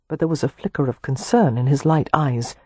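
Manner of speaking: plain reading voice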